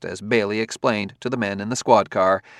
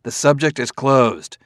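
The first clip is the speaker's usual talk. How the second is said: louder, cutting him off firmly; raised voice; 3 semitones higher, 5 dB louder, slower than usual. Acted. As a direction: shut the topic down, voice up. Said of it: "Bailey's voice rose".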